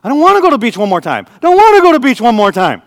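put-on high, loud pleading toddler; whining child. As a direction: whine it like a clinging three-year-old. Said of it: "She goes".

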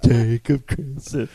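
slow, dopey Team America Matt Damon; Matt Damon puppet impression. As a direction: drag the name out dumbly. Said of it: "the Matt Damon thing from Team America".

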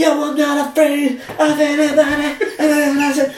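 high, slow mock rap voice. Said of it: "the bit where [name] comes in".

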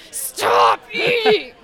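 high, loud, slow shout; angry yell. Retold or imitated. Annoyed at people eating noisily, hammed-up angry shout. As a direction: yell it at someone chewing loudly. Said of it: "I'm like".

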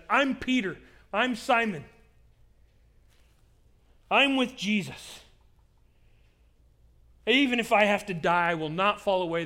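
bold declaration. Acted. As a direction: declare your loyalty out loud. Imagined bold declaration by Peter.

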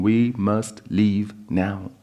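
slow, deliberate, heavy; slow earnest plea. Acted. Wife pleading with her husband to leave, slow and earnest. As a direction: spell it out slowly to convince him.

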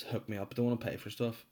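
ordinary delivery

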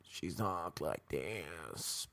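slow, soft, droning robot voice. Retold or imitated. Comedian mimics the DMV woman's robot voice, slow and droning. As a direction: grind each word out like a machine. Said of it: "a woman in there with a robot voice".

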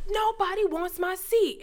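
high, slow mock complaint; imitating aggrieved man. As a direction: lament it high and drawn out. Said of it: "He was like".

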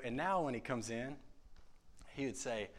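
ordinary talk

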